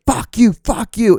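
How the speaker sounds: high, drawn-out road-rage imitation; mock angry yelling. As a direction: imitate a driver yelling, slow and high without full volume. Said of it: "like, yelling at me".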